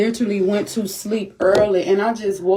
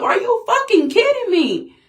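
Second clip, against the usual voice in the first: high-pitched furious disbelief; outraged disbelief; 11 semitones higher than usual. Real, not acted. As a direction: cry out in disbelief. Outrage at neighbor shooting.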